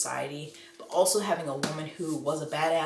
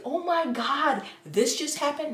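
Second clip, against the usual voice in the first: excited gasp; 7 semitones higher, 3 dB louder than usual. Retold or imitated. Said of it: "And I would be like".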